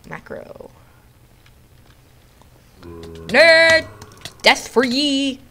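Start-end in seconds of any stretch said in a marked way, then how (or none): 2.8-5.4 high, loud taunt; mock battle cry. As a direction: shout it high like a playful war cry.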